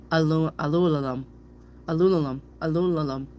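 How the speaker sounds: aluminum garbled into "alulalam"; tongue-tied. Shown as a demonstration. Reader showing a word she can't say, tongue-tied "alulalam". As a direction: stumble over it, all l sounds: "alulalam". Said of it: "a word I can't say".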